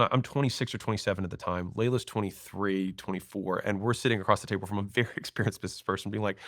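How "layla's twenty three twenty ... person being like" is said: ordinary talk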